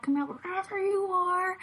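high singsong hide-and-seek call; taunting clown. Retold or imitated. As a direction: lilt it high like a stalking clown. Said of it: "He's like".

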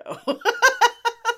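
high, giddy burst of laughter; high giggle. Real, not acted. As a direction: squeal with delight. Giddy about Broderick con.

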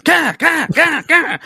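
stabbing grunts. Pretend. Assassin stabbing from the pipes.

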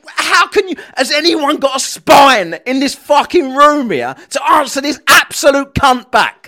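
loud, high, incredulous demand; exasperated outburst. Real, not acted. Pleading for someone to answer the bully back, exasperated outburst. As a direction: raise the voice in fed-up disbelief. Exasperated plea to the clip's participants.

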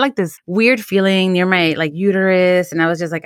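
usual speaking voice

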